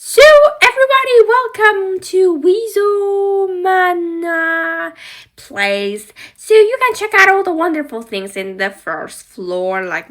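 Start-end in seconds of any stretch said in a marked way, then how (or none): 0.0-8.0 high, loud showman greeting; high announcing host voice; wizard welcome. Pretend. Wizard doll welcomes guests.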